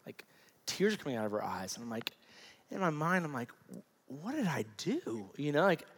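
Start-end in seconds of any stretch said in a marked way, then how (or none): 4.1-5.3 soft, slow, retold bewildered thought; dismayed inner thought. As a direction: quietly replay a stunned question to yourself. Inner thought, seeing wife crying.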